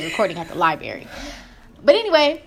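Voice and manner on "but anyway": high, giggly restart; laughing it off. Real, not acted. Laughs at own outburst.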